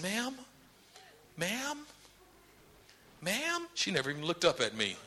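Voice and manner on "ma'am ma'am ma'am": very soft, rising, tentative call; timid approach. Acted. Bashful helper to screaming lady, timid. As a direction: try meekly to get someone's attention.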